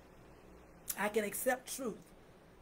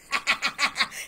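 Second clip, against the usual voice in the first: hearty laugh; 3 semitones higher, 8 dB louder than usual. Real, not acted. Laughing at her own joke.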